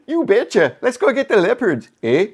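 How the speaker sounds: teasing Canadian impression, eh at the end; exaggerated Canadian accent, mocking the other speaker. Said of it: "was that your Canadian accent?".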